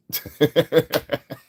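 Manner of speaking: a high loud burst of laughter; a high loud real laugh. Real, not acted. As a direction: crack up at his own phrasing. Laughs at own phrase.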